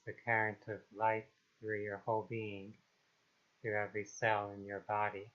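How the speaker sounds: calm, unhurried meditation voice with long pauses; guided meditation. Acted. Meditation guide helping listeners release trauma and anxiety, calm and unhurried. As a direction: ease them gently into stillness, phrase by phrase.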